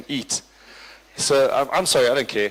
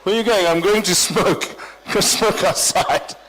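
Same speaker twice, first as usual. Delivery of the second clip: mocking smokers; 5 semitones higher, 3 dB louder than usual. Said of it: "Everyone was like".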